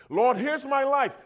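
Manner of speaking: a hint of a showy prayer, lifted up high; mock grand surrender. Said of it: "Here's how some people surrender themselves".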